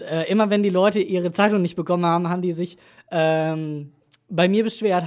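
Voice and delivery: ordinary talk, nothing marked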